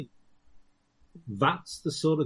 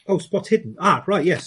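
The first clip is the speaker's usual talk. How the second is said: startled; 7 semitones higher, 11 dB louder than usual. Real, not acted. Suddenly realises it's his roll.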